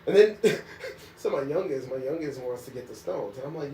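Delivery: normal talking voice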